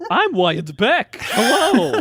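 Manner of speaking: high, slow, goofy villain voice; Wired Beck voice. Acted. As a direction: draw out a strange, silly character voice. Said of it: "busted out my Wired Beck impression".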